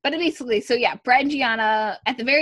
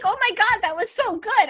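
high-pitched, gushing imitation of praise; mock gushing, pitched way up; 11 semitones higher than usual. Brett praising Casey's accent, imitated gushing. Acted gushing reaction in retold scene.

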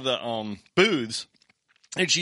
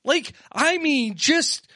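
mock outrage; 12 semitones higher, 3 dB louder than usual. Ranting at riders, voice pitched up.

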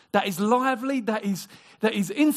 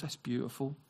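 lower, hushed awe; soft marvel; 7 semitones lower, 8 dB quieter than usual. Retold or imitated. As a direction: marvel quietly. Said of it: "He goes".

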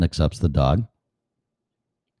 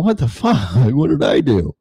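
much higher, indignant put-on dog voice with a laugh; outraged pet, pitched way up, laughing; offended dog voice; 6 semitones higher than usual. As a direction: squeal it like a wronged old dog. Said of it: "the eight-year-old dog's going".

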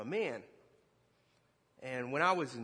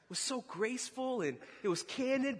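ordinary talk

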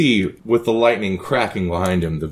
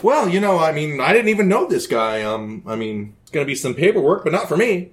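much higher, giddy manic emperor chatter; Caligula, coked-out host; 10 semitones higher, faster than usual. Acted. Caligula fawns over the guests on his boat, high and manic. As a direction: play a wired, delighted emperor.

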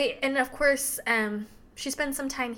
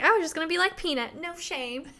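higher and fast, a playful mock boast; mock cheeky voice; 6 semitones higher, 5 dB louder than usual. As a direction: rattle it off cheekily, up high. Retold cheeky heroine line.

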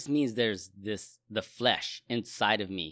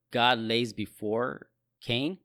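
normal talking voice

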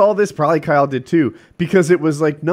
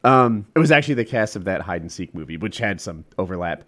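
ordinary delivery

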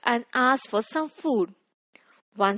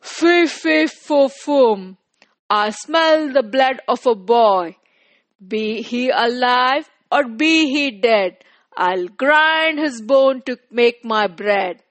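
booming giant; 5 dB louder than usual. Acted. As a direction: boom it out like a giant. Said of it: "The giant cried".

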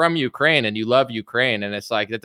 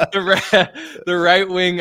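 real laughter breaking into the words; laughing; 6 semitones higher, 5 dB louder, slower than usual. Real, not acted. Cracking up while making his point to co-host, laughing. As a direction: crack up and try to carry on talking.

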